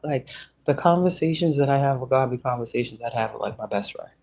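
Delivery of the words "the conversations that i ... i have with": ordinary delivery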